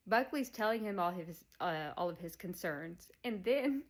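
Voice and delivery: ordinary delivery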